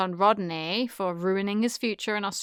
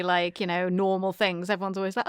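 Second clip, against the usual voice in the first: ordinary talk, nothing marked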